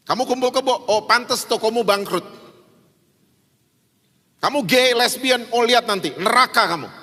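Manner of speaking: high, scolding judgement; mock condemnation. Believer condemning sinners from the pulpit, scolding. Mimics condemning bad-news preachers.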